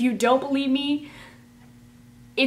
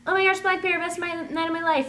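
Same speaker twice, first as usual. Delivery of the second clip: much higher squeaky mock fangirl; 8 semitones higher, faster than usual. Friend [name] mocking her squealing fangirl voice. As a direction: parody her gush, high and silly. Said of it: "mocking her".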